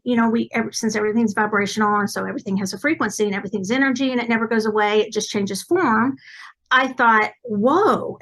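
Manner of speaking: ordinary talk, nothing marked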